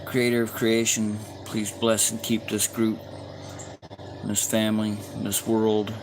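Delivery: heartfelt spoken prayer. Real, not acted. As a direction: pray over the group gently. Closing prayer for the group.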